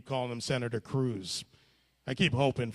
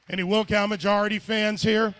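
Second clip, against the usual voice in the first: loud rally call to the audience; shouting to the crowd; 6 semitones higher, 7 dB louder, faster than usual. Real, not acted. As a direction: call out to the whole room. Calling out to the crowd.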